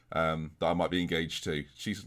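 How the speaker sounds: ordinary talk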